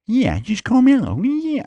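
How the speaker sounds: high, put-on mobster voice; hammed-up wiseguy squeal; Sil impression. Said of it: "I sounded more like, uh, the other dude, Sil".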